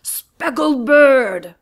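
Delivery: very slow loud roar; roaring lion. Acted. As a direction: bellow at the bird. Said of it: "roared Lion".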